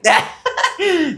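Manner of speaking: real amused laughter; high laugh. Real, not acted. Laughing at own story.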